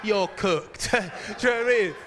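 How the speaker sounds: loud mock taunt; gloating taunt. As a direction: imitate a gleeful brother's jeer. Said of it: "my brother's just like".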